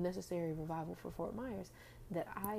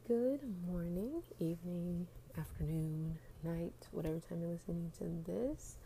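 ordinary delivery

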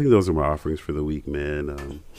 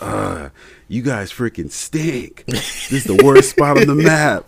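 mocking an angry teammate, slow then louder; huffing and puffing rant; 7 semitones higher, 7 dB louder, slower than usual. Random teammate ranting about the drop spot, mock angry. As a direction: imitate a whiny gamer's rant, rising to a shout. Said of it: "just huffing and puffing rant".

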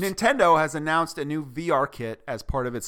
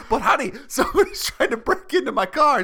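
pleading husband; 7 semitones higher than usual. Retold or imitated. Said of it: "You're on the couch".

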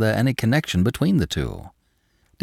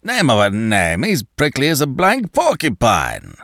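protesting Danton; 4 semitones higher than usual. A narrator's reading. Danton protests to Le Chapelier, blustering. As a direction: scoff at him. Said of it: "Danton protested".

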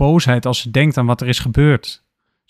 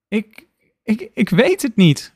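stammering cry; 9 semitones higher, slower than usual. Acted. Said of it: "roept Lena".

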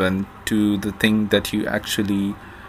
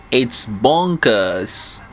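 attempted English accent; much slower than usual. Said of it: "my attempt at an English accent".

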